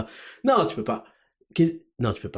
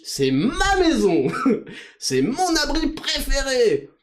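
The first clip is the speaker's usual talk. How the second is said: mock listener; 8 semitones higher than usual. Absurd listener persona.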